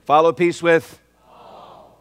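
slow, loud, drawn out; slow emphatic reading. Real, not acted. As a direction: lead the church through the verse. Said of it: "until we all say it together".

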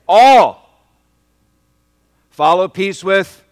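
shouted high, slow prompt; loud, slow, high call and response prompt; loud call to congregation. Real, not acted. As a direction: call them to answer out loud. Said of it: "until we all say it together".

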